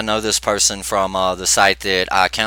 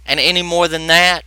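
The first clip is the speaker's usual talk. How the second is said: normal talking voice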